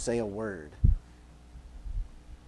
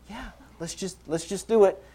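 small high voice, agreeing along; eager woman; 7 semitones higher than usual. Acted. Desperate woman agrees with the disciples, eagerly. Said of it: "She's probably like".

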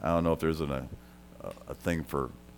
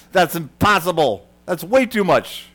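higher, louder mock disbelief, as if it can't be; incredulous scoff; 10 semitones higher, 10 dB louder than usual. Jesus's audience scoffing at an impossible debt, incredulous. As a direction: scoff at the idea like a doubting crowd. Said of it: "the people then in the audience are like".

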